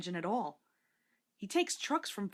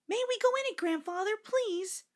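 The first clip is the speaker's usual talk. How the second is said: put-on much higher pleading child voices; begging children; 13 semitones higher than usual. A narrator's reading. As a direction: beg grandfather for a ride.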